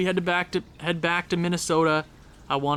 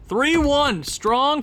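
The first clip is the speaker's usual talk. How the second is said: excited; 9 semitones higher, 3 dB louder, much slower than usual. Real, not acted. Goal celebration in a game.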